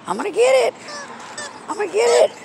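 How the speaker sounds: high mock threat rising at the end; playful high taunt. Owner teasing her cat over the ball, high playful taunt. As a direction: tease the cat that you're coming for the toy.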